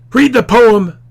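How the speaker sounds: teacher acting the screamed example; mock scream. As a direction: yell the command across the room.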